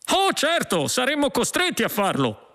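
very high-pitched eager agreement; emphatic policeman. Acted. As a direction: back up your partner high and emphatic. Second policeman backs up the threat.

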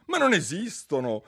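high and loud indignant protest; Alice snapping at the dormouse's tale; irritated outburst. A narrator's reading. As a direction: burst out, annoyed. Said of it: "saltò su Alice alquanto stizzita".

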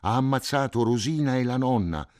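put-on a terrified boy crying out the news; yelling in fear; frightened shouting. A narrator's reading. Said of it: "gridò Cinto".

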